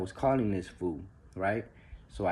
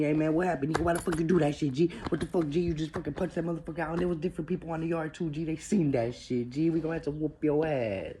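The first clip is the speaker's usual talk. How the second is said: higher, fast, scolding homeboy voice; scolding homie; 7 semitones higher than usual. Retold or imitated. His homie scolds him for the punch, higher scolding voice. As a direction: rattle off the scolding like an upset friend.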